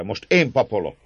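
kissé emelt, derűs hang; jókedvű. A narrator's reading. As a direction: vedd át a szót jókedvűen. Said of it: "folytatta Emez jókedve". (jókedvűen)

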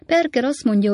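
ordinary delivery

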